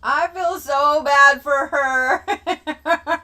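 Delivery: high, loud, stretched-out laughing line; laughing through words. Real, not acted. Feeling bad for her sister with covid, laughing through words. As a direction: laugh while saying it.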